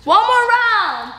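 high, upbeat workout calls; fitness coach. Acted. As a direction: pump the class up. Leads workout round.

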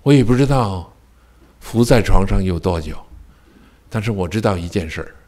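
ordinary talk, nothing marked

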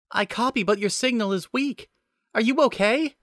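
put-on high young woman's voice; Nicole's voice. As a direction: raise the pitch for Nicole.

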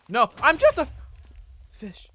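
high, loud hammed-up cry; mock panicked plea. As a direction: squeal for mercy. Pleading as attacked.

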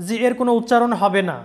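ordinary talk, nothing marked

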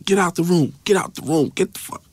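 high, repeated command without much volume; angry order. Retold or imitated. Detective kicking Arroyo out, high mock-angry order. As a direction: imitate an urgent cop clearing the room.

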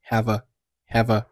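"have a" run together as one word; linked sounds. Shown as a demonstration. Teacher showing learners the linking in "have a", linked. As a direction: link the v of "have" straight into "a". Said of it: "have和a是连读成了".

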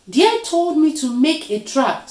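high, loud self-defence; defensive protest. A narrator's reading. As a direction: push the blame onto another. Spider defends himself in quarrel.

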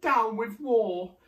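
high, loud, slow hoot; little dinosaur's hooted chant. A narrator's reading. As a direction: hoot it as a drawn-out protest. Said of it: "And he hooted".

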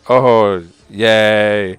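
drawn-out loud cheer; loud half-hearted cheer. Real, not acted. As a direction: cheer loudly over a middling prize. Underwhelming three-star pull revealed.

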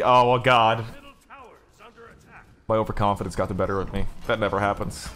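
normal talking voice

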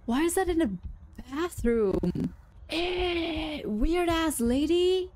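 disgusted. Real, not acted. Disgusted at sausage in bathroom.

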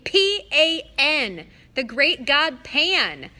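each letter slow, high and loud; spelled out slowly. Acted. Grover insisting to Percy on the name, slow loud spelling. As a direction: spell it out like he's dense.

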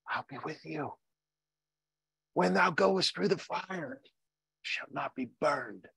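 whispered promise. Acted. God comforting the boys facing the furnace, hushed. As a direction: breathe it into their ear. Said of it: "the Lord whispered in their ear".

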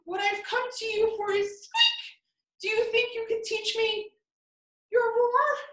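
high, timid squeaky mouse voice; nervous little mouse. Acted. Mouse asking sleeping lion to teach him, high timid voice. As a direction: ask politely while trembling.

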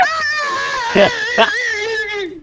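high screaming laughter; shrieking laugh. Real, not acted. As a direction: laugh till it cracks into a shriek. After the standoff.

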